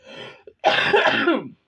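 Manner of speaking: loud hacking cough; one loud rough cough; harsh sick cough. Real, not acted. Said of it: "that's a sick cough".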